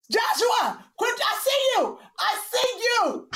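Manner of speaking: calling out. Real, not acted. Mother spotting Joshua sneaking off, calling out. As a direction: call across the house, pitched well up. Mother calls out to hiding son.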